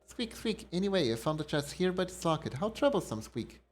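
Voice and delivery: high, tiny squeaking critter voice; friendly little mouse, worried in a creepy place; squeaky mouse creature. Acted. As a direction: pipe it up like a little mouse folk. Voices squeaking mouse character dialogue.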